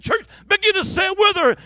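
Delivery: high, loud call to the congregation; rally the whole church to join. Retold or imitated.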